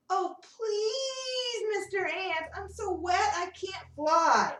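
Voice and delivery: high, whiny plea with a tearful edge; pleading butterfly. Acted. Wet butterfly begs the ant again, high teary plea. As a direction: beg as if near tears. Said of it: "He comes over and says".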